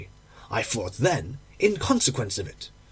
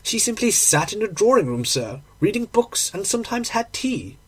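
higher-pitched, respectful landlady; 6 semitones higher than usual. Acted.

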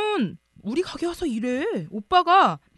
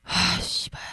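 cursing under breath; 6 dB quieter, slower than usual. Acted. Cursing after being dumped.

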